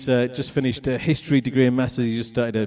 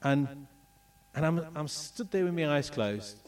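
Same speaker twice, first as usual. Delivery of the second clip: ordinary talk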